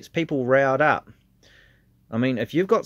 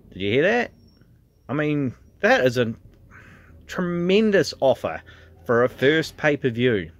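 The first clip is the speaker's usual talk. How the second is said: hyped ad read; 5 semitones higher than usual. Acted. Vlogger pushing the promo code to fans, hyped announcer read. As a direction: sell it like a wrestling announcer.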